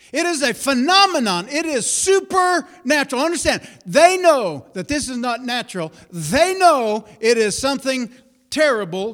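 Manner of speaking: loud, high, driving sermon delivery; fiery preaching. Acted. Preacher rousing the congregation with full-force preaching. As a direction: preach it hard and build the fire.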